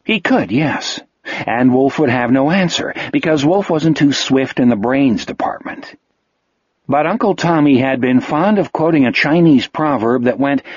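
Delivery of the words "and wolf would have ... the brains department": normal talking voice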